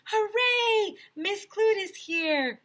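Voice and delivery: high, loud excited cheer; gleeful group shout. A narrator's reading. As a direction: cheer it out like a room of kids. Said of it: "we all shouted".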